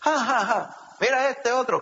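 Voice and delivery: high mock laugh running into a jeer; grandmother's mocking laugh. Grandmother laughs at a would-be dandy, mocking. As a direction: imitate a grandmother poking fun. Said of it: "lo usaba mi abuela mucho".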